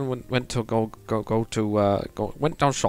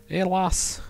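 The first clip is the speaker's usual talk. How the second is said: Scottish accent; 5 semitones higher than usual. Shown as a demonstration. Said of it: "Scottish is the furthest away from me".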